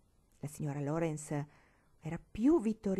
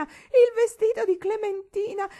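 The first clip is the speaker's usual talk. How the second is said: even higher, louder, slow sobbing shriek; weeping shriek; 11 dB louder than usual. A narrator's reading. Old lady mourning the dress, higher shrieking wail. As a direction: shriek the repeat, grief climbing. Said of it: "Piangendo, la vecchia signora gridava".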